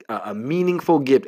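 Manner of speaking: usual speaking voice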